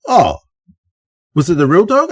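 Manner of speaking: retold wide-eyed question; imitating dismayed listener. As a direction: play a listener troubled by the news. Upset workmate questions him, acted out.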